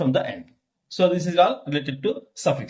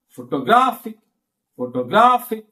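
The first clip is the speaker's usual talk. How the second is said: "Photographic" with GRA raised high; stress on third syllable; 4 semitones higher than usual. Shown as a demonstration. Teacher repeating demo for class, strong third-syllable stress. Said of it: "Like that".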